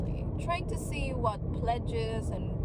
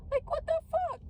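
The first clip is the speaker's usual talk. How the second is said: much higher, strained cry of disbelief; incredulous outburst. Real, not acted. Mocking offset logic to viewers, incredulous high outburst. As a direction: throw it out, utterly fed up.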